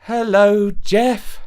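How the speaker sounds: a reluctant, forced hello. A narrator's reading. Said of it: "she replied reluctantly".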